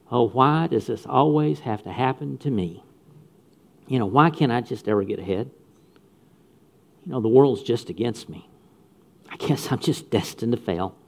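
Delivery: mock whining. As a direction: act out a sorry complainer, lightly. Mock complaint of self-pitier.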